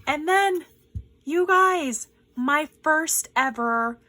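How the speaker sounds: high, loud, drawn-out announcement; excited build-up. Real, not acted. Building up to the big gift.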